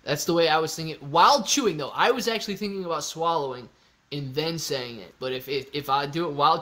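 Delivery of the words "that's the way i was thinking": ordinary delivery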